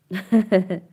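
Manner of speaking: short, loud giggle; brief burst of real laughter. Real, not acted. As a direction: burst out in a quick chuckle. Laughs during live chat.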